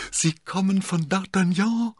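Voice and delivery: high, loud joyful outburst; jubilant. A narrator's reading. Constanze learns who sent the visitor, loud jubilation. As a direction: burst out in jubilation. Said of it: "brach lauter Jubel aus ihr hervor".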